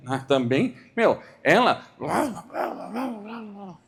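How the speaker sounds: slow complaint fading to blah blah; complaint that fades into near-silent blah blah; mock complaining. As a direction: grumble then trail off. Mocking complaining colleague.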